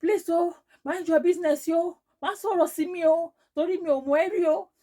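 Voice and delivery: high, loud indignant warning; heated high-pitched telling-off, imitated; retold anger. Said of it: "I was getting angry".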